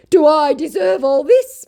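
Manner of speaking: high, slow, hammed-up self-pity; mock lament. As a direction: play the poor victim, half-joking. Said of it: "my scammer laments".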